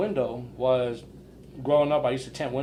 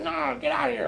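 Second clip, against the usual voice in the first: brushing him off in a raised voice; imitating the owner shooing him away; higher mock dismissal; 11 semitones higher, 4 dB quieter than usual. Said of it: "He was like".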